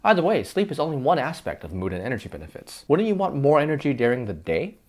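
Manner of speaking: normal talking voice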